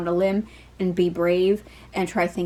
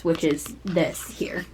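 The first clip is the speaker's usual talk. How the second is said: breathy whisper, slowed right down; whispered aside; 2 semitones lower, slower than usual. Real, not acted. Whispering an aside to viewers about the card. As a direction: lean in and whisper it like a secret.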